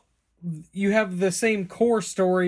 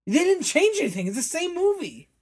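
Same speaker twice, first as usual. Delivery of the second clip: high-pitched, worked-up complaint; exasperated protest; 11 semitones higher than usual. Real, not acted. Complaining to a friend that they didn't change anything, exasperated. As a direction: insist on it in a much higher voice. Arguing the remake changed nothing.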